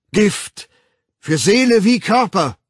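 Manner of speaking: loud, high cry of warning; impassioned warning. A narrator's reading. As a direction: cry it out to the crowd in broken phrases. Savage warns the crowd of poison. (laut warnend)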